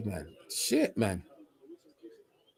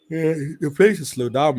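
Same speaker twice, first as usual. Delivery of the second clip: words stretched by a yawn; 3 dB louder than usual. Real, not acted. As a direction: speak through a yawn. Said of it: "sorry for yawning".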